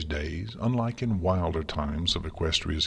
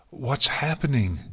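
alarmed; 7 semitones higher, 3 dB louder than usual. A narrator's reading. Twilight alarmed as mentor collapses.

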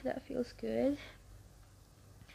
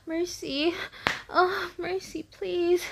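higher, louder desperate pleading; loud mock begging; 5 semitones higher, 4 dB louder, slower than usual. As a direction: beg for mercy, half playing. Begging for mercy in scene.

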